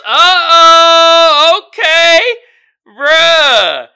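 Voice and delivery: high, loud excited yelps; excited shouting. Real, not acted. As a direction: shout it out with delight. Reacting to meme, shouting.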